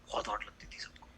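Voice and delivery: hushed whisper about her; conspiratorial whisper; whispered aside. Retold or imitated. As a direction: whisper it like a secret.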